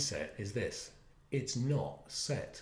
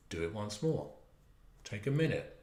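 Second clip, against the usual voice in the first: ordinary talk, nothing marked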